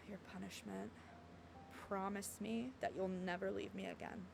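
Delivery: low, hushed, intimate line; soft dialogue reading. Acted. Heroine asking him never to leave, soft and low. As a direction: murmur it low and softly. Reads game dialogue aloud.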